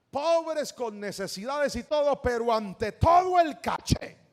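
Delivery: loud, high, slow proclamation; emphatic preaching. Acted. Preacher stressing a motto to the congregation, emphatic. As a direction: hammer it out slowly to the crowd.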